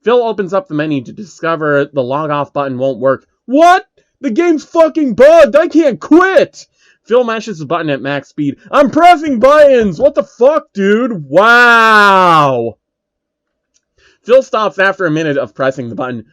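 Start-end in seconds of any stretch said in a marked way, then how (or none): 3.5-12.8 very high, loud, stretched-out outraged yelling; panicked rage. Acted. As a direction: scream in disbelief at the broken game. Can't log off, mashing buttons.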